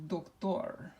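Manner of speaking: Spanish doctor, with the weight on "tor"; stress on last syllable. Shown as a demonstration. Teacher shows class the pronunciation, doctor stressed on the final "tor". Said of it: "the way we pronounce this is".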